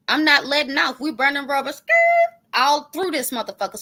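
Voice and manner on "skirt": high, loud skirt like tires; tire screech. Pretend. Mock tire squeal while she boasts of mashing the gas. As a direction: squeal skirt like burning rubber.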